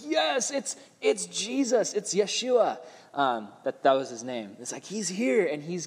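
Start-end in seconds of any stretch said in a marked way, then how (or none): 0.0-2.8 lightly voiced high cheer as Jesus arrives; a hint of a thrilled crowd; excited crowd. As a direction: greet the king with a mock shout of joy, pitched up. Crowd greeting Jesus joyfully.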